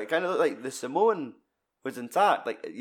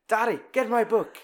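put-on bratty kid; 9 semitones higher, 3 dB louder than usual. Retelling kid astronomer's tantrum.

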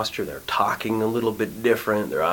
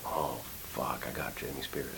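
low, soft retold realization; hushed realization; 5 semitones lower, 11 dB quieter than usual. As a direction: drop low and quiet, as if it just dawned on you. Retold moment of awed realization.

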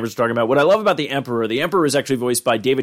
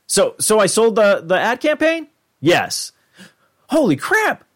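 excited Jerry; 9 semitones higher, 3 dB louder than usual. Retold or imitated. Imitating Jerry learning his pitch sold, rising giddy squeal. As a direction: voice jumping higher with giddy disbelief.

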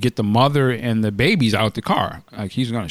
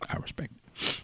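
repeated in a breathy whisper; 13 dB quieter than usual. Shown as a demonstration.

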